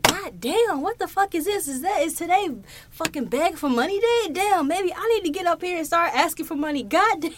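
riled-up lady on the train, half-performed; retold loud outrage. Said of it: "she said this out loud".

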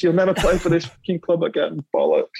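mimicking the row. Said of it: "There's me saying this".

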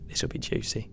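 gleeful whisper; whispered thought. Retold or imitated. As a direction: whisper it like a guilty pleasure. Retells eager thought reading article.

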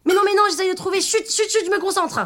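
high, eager insistence; excited guessing. Acted. Léa trying to guess mother's dish, high and excited. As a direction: beg for silence while racing to guess.

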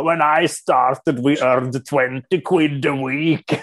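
put-on slow, drawn-out old British football man; old football chairman. Woman retelling a radio interview with an old football fan, hammed-up old British character. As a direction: play the nostalgic British club character. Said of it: "Det var mycket den stämningen".

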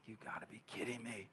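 very soft, low grumble; hushed, weary-sounding mock complaint; grumbling disbelief. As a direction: grumble it under your breath. Grumbling on waking at night.

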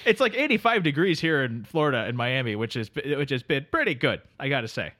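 sleepy Canadian drifter greeting his pal; Canadian accent. Acted. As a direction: play the neighbourly Canadian couch guest. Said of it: "my very cold Canadian heart".

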